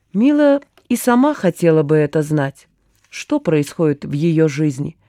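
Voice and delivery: usual speaking voice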